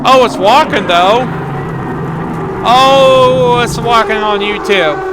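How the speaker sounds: real excited disbelief; amazed. Real, not acted. Player watching the burning wolf walk, amazed.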